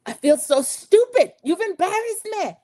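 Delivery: mock upset outburst. [name] telling Rob he embarrassed her, mock upset. Betrayed contestant's outburst acted.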